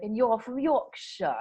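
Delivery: slow imitation of Yorkshire English; Yorkshire accent. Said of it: "taking me through the different English accents".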